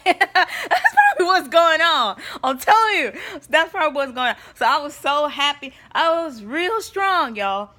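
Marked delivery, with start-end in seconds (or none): 0.0-4.4 loud laugh through the words. Real, not acted. Laughing at her joke to her viewers, loud and high. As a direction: crack up at your own joke.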